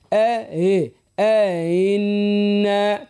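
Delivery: very slow chanted آئنا, each sound held; long first hamza. Shown as a demonstration. Teacher leading listeners to repeat, very slow chanted آئنا. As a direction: hold آي long, then glide through آئنا. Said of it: "تحقيق الصوت متاعها مع مد".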